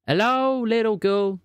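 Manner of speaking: high, drawn-out teasing call. Acted. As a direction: sing out a long, high greeting down the phone. Unmutes and calls to silent caller.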